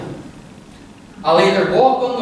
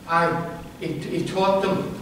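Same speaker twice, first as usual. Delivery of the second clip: normal talking voice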